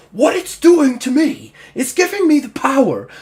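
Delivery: high, loud orc tirade; shouted megalomaniac boast; power-mad rant. Acted. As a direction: bellow it like a raging tyrant. Orc ranting about power in quarrel.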